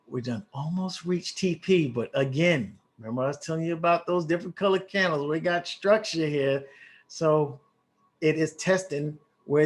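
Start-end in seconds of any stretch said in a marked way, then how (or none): none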